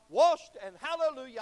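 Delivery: exclaiming. Real, not acted. Preacher exclaims.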